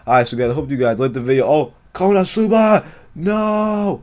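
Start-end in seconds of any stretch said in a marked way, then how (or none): 1.9-4.0 high, drawn-out cry; excited outburst. Real, not acted. Spotting Konosuba at the video's end, excited cry. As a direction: call it out high and stretched.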